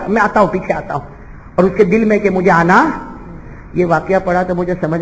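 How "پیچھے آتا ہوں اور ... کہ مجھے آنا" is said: normal talking voice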